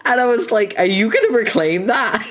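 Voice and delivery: high, giggling through the words; laughing. Real, not acted. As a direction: laugh it out, barely holding it. Laughing while retelling chat joke.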